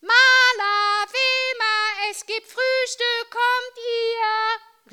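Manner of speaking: high sing-song call across the flat. Acted. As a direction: call them to breakfast. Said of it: "rief Mama durch die halbe Wohnung".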